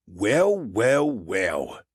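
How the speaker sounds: a thug drawling his threat from the shadows; rough voice. Acted. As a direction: mugger dwarf taunting from the shadows, rough voice. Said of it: "came a rough voice from behind us".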